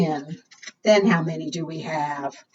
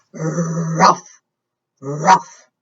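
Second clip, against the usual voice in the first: dog barking its name; 4 semitones lower than usual. Acted.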